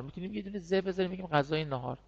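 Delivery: ordinary talk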